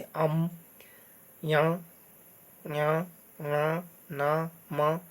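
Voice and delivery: "अम, ञ, ङ, न, म" sounded through the nose; nasal sounds. Shown as a demonstration. Teacher showing learners which letters come through the nose, nasal sounds. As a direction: recite "ञ, ङ, न, म" separately, each through the nose. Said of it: "नासिका यानी नाक से किनके उच्चारण होते हैं".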